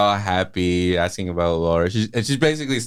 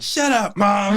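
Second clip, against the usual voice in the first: high-pitched comic outburst; whiny, hammed-up irritation; mock exasperated son; 12 semitones higher, slower than usual. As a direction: snap at mom in exaggerated protest. Son snapping at mother in retelling.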